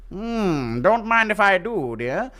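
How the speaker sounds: high, hammed-up gallant greeting; old-time gentleman suitor. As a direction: purr it like a mustache-twirling charmer. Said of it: "And you twiddle your mustache like this".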